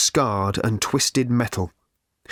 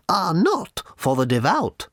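slow, high stern little lady; lightly voiced severe shopkeeper; a hint of a stern little lady; 7 semitones higher than usual. A narrator's reading. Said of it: "said the little lady severely".

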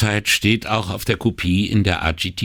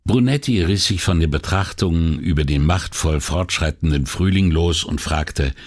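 usual speaking voice

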